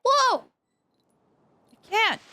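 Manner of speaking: ordinary talk, nothing marked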